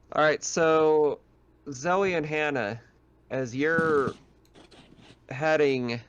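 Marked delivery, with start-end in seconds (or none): none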